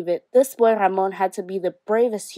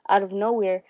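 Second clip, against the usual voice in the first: usual speaking voice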